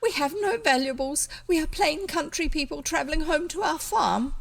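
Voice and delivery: a nervous squeak of a lady; squeaky. A narrator's reading. Cousin Bet pleads with the robber, high and squeaky. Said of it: "Cousin Bet's voice was quite squeaky".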